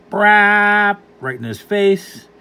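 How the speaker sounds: comic fart imitation, loud and slow; loud mock fart sound. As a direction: blast the fart noise out slowly.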